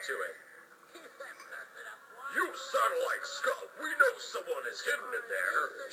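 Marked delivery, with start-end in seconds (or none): 2.3-5.9 shouted. A narrator's reading. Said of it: "shouted the officer".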